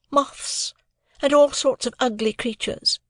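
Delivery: put-on a proud young woman answering lightly; Estella voice. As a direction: play Estella, poised and aloof.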